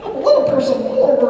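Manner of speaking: very high, loud cartoon voice; little chicken monster. Actor clowning for cast and crew, shrieky high chicken monster. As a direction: squeal it like a silly little creature. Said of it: "just this monster thing".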